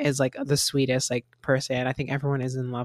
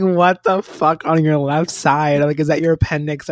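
alarmed shout; 7 dB louder than usual. Retold or imitated. Everyone shouting at him about his left side, loud and alarmed. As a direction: yell it like you fear for his appendix.

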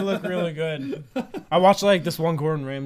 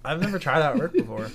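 normal talking voice

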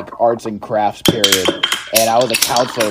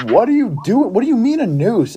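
high, incredulous mock scolding; retold flip-out; 11 semitones higher than usual. Counselor grills a kid about the noose, retold flip-out. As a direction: question him in a pitched-up, exasperated voice. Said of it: "I legitimately flipped out on a kid".

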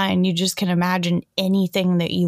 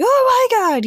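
much higher, louder gushing squeal; excited squeal; 5 dB louder than usual. Retold or imitated. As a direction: gush like a giddy friend. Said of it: "she's just like".

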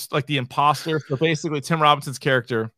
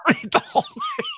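high, fast squeaky mock echo through laughter; giggling imitation of a friend; laughing mimicry; 4 dB quieter, much faster than usual.

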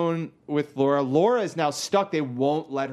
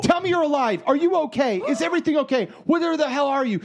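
frantic texts voiced; 10 semitones higher, 4 dB louder, faster than usual. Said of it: "She's like".

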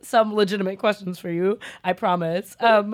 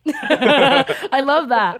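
laughing; 5 semitones higher, 7 dB louder, much slower than usual. Real, not acted. Laughing delight at guest.

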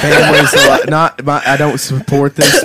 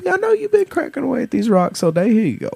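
nice Southern warden, soothing the prisoner; Southern accent; 8 semitones higher than usual. Acted. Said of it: "You can't say Toby in a Southern accent".

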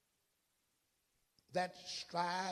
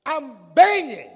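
high, forceful shout; loud insistent cry, last word drawn out; shouting at the door; 12 semitones higher, 20 dB louder than usual. Acted. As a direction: shout it as if pounding to get in. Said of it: "But he said".

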